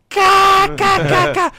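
high, loud cackle; cackling laugh. Retold or imitated. Girl on the classroom floor, high cackling laugh. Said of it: "Ela tava deitada no chão".

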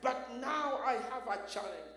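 loud, urgent cries to God, pushing every phrase; impassioned loud prayer. Acted. Jacob begging God for mercy, in a loud impassioned prayer. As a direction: preach it as a desperate plea for mercy. Preaching at full force, voicing Jacob.

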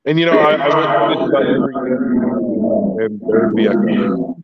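muffled, echoing line; sounding submerged on a call; underwater. Real, not acted. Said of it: "You sound like you're underwater".

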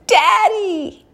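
high, drawn-out cry for Daddy; calling out. Retold or imitated. Believer in trouble calling to God like a child, yelling. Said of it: "I can still go".

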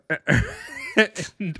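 a high, airy burst of laughter. Real, not acted. Laughing while retelling the waiter's joke, high airy laugh. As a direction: crack up, high and light.

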